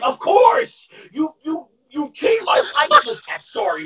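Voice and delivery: high, loud excited outburst; excited protest. Retold or imitated. Retold excited protest to mom.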